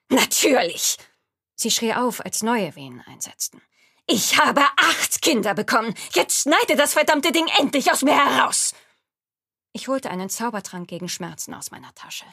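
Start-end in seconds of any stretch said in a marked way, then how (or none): 0.1-1.0 angry snap. Acted. Woman in labor snapping back. 4.1-8.7 loud, high, furious yelling in pain; furious shout. Acted. Woman in labor shouting furiously.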